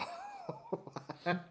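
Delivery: sudden high-pitched laugh at the absurdity; high burst of laughter. Real, not acted. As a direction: let a high laugh break out. Laughs at the pie story.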